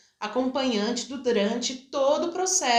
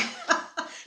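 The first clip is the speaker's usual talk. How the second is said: breathy burst of laughter; brief amused laugh, no words; short laugh; 3 dB quieter than usual. Real, not acted. Doula laughs at her own bleak answer, amused.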